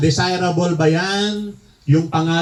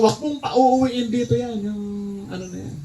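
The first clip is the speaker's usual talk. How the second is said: angry grandfather; 6 semitones higher, 4 dB quieter than usual. Retold or imitated. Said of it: "lalo nang galit na galit".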